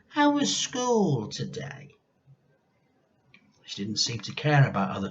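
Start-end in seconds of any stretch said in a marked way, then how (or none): 0.1-2.0 higher, sugary kind granny voice; a mock-sweet granny greeting; imagined kindly grandma greeting George. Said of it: "had she smiled at George and said".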